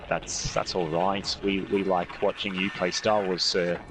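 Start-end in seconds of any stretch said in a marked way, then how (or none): none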